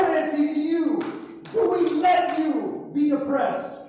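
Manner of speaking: preacher rebuking a demon, forceful; commanding deliverance. Acted.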